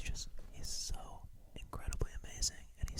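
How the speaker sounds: very soft whispered praise; barely audible whisper. Pretend. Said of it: "Your levels were kinda low there".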